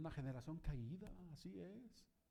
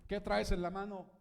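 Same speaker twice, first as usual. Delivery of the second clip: God scolding Moses; 5 semitones higher, 11 dB louder than usual. Acted. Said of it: "Y le dice".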